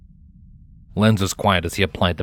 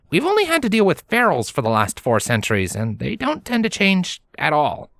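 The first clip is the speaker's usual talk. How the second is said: put-on lightly higher young stallion voice; Lenz voice; 10 semitones higher than usual. A narrator's reading. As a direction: voice the young hero, a bit higher. Lenz continues.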